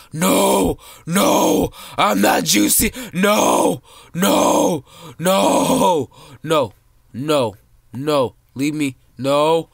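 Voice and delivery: loud, high repeated no; panicked screaming. Real, not acted. Kid gaming, screaming as bigger players chase and eat him. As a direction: scream for your life. Being eaten in game.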